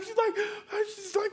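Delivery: ordinary talk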